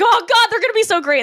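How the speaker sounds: high, loud gushing; mock excitement. Comedian imagining a dying man thrilled by future phones, mock excited. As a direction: gush with hammed-up, high-pitched delight.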